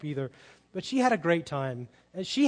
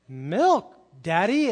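put-on louder, insistent toddler demand, rising at the end; an indignant little girl insisting on milk, louder; 2 semitones higher, 8 dB louder, much slower than usual. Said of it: "She looks a little indignant".

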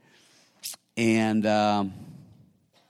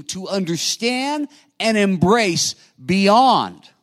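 much higher and louder, slow and stretched; climactic preaching; 12 semitones higher, 12 dB louder, slower than usual. Acted. Pastor urges congregation to forgive, slow soaring preaching cry. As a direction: drive home the final plea.

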